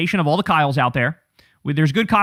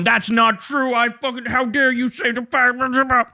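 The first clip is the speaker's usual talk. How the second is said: mock whiny Vance; 5 semitones higher than usual. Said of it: "J D Vance is all up in his own replies going".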